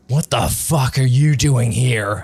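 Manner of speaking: put-on loud, startled demand from a drunk; an angry man barking at an intruder; angry NPC snap.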